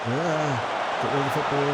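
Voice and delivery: urgent shout. Real, not acted. Urging defenders under attack.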